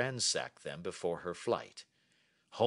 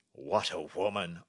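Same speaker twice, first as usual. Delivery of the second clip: king's exclamation, fairly contained; first, measured cry of admiration; admiring cry; 2 semitones lower than usual. A narrator's reading. King marvelling after reading her letter, admiring cry. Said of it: "cried the King of Bohemia".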